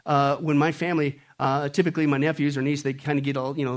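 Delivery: normal talking voice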